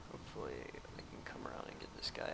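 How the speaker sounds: hushed whisper while sneaking. Real, not acted. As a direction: whisper like you're hiding. Sneaking up on enemy.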